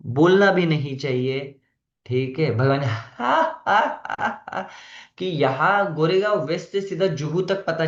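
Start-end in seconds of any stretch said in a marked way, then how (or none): 2.5-4.7 a high, booming mock belly laugh; loud mock laugh. Teacher imitating a devotee laughing too loudly, loud mock laugh. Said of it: "रक्षण कृष्णदास हंस रहा है".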